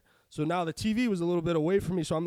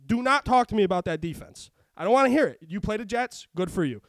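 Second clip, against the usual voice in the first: louder, heated listing of quarterbacks; angry rant; 4 semitones higher, 7 dB louder than usual. Real, not acted. Ranting to his co-host about the Patriots defense, angry and loud. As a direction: rail against the overrated defense. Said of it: "Rant over".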